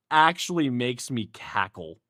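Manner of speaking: usual speaking voice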